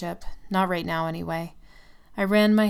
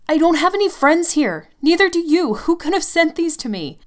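much higher and much louder, near screaming; desperate shout; 11 semitones higher, 10 dB louder than usual. Acted. Girlfriend screaming at Tim about the flowers. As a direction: shriek it at him.

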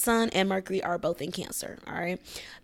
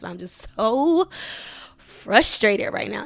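slow, louder, emotional and strained; pushing the words out through real frustration; 8 dB louder, slower than usual. Real, not acted. To her listeners about her rough day, slow and strained with emotion. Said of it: "I feel myself getting emotional".

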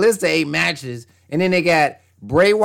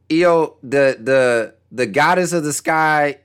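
normal talking voice

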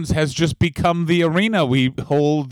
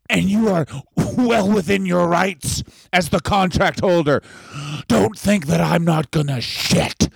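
ranting promoter, raised pitch slowing to a threat; Paul Heyman; 4 semitones higher, slower than usual. Acted. Promoter threatens the network that cancelled him, angry and emphatic. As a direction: build a grand threat, drag out the end. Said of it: "gets as angry as Paul Heyman".

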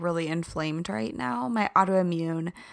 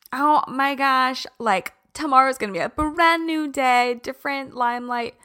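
mock excitement; 5 semitones higher, 6 dB louder than usual. Mocking past New Year hype.

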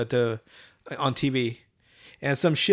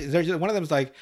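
usual speaking voice

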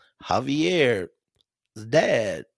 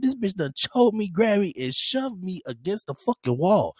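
a bit higher, lightly strained retelling; a hint of voice cracking; 8 semitones higher than usual. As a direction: keep a trace of the cracked high voice. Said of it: "My voice cracked and all".

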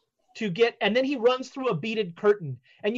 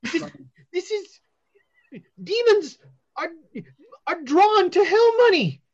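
higher, louder lecture; seer voice; 7 semitones higher, 4 dB louder than usual. Acted. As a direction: explain it as a mystic. Fortune teller explains.

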